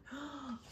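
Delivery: sharp storybook gasp; gasp of dismay. Acted. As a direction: gasp in alarm for the turkey. Reacting to being on dinner list.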